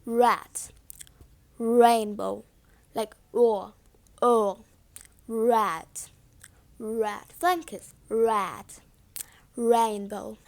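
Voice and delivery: "rat" and "rainbow" said low and soft; quiet model words, smooth untapped R in "rainbow"; British R demo. Shown as a demonstration. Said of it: "For example".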